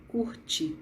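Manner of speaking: "curte" whispered, with no voice; whispered word. Shown as a demonstration. Teacher whispers "curte" to the class, unvoiced. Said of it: "C U R T I".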